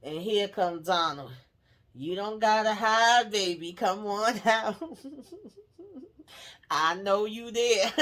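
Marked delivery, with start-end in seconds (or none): 2.0-7.9 slow, higher, louder singsong call, half laughing; Donald's playful coaxing. Retold or imitated. Donald calling Lily out from hiding, playful coaxing. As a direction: draw it out, coaxing someone from hiding.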